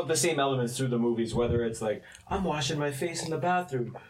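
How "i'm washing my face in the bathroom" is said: acted fright. Acting out a horror scene.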